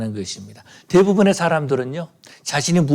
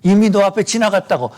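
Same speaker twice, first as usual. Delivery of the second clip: higher, louder scolding that builds; hushing rebuke; 6 semitones higher, 4 dB louder than usual. Retold or imitated. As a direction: shush him sharply, then snap. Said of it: "이렇게 말씀할지 모릅니다".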